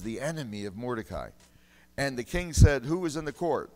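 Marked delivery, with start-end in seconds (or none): 2.0-3.7 much louder revival preaching. Acted. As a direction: drive it home to the congregation. Preaching at full force.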